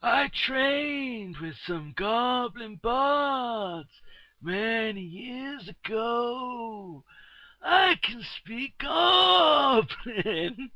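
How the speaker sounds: slow, high theatrical bard voice; [name]'s character voice. Acted. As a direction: drawl it out grandly like a bard. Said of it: "he tells you".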